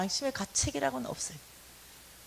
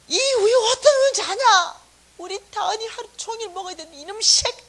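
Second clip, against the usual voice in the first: high, loud furious scolding of the son; furious yelling; 10 semitones higher, 11 dB louder than usual. Retold or imitated. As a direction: explode at the kid, stressing 어떤. 아들 야단침 반복. (아들에게 높고 크게 화내며 소리치는 말투)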